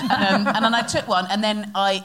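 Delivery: ordinary talk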